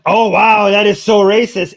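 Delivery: loud mock shock; sarcastic. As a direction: feign outrage, laying the sarcasm on. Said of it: "I'm being sarcastic".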